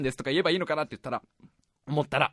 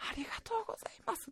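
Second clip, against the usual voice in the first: breathy high whispered thanks; a frail grandma whispering gratitude; whispered old lady; 18 dB quieter, much faster than usual. Retold or imitated. Grandmother thanks the young man in a high whisper.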